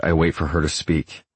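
ordinary delivery, nothing marked

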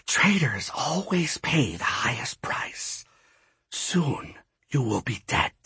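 slow, whispery threat; hushed menace, drawn out slowly; menacing goddess. A narrator's reading. Ishtab turns to Quinn, whispering menace.

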